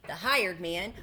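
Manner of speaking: usual speaking voice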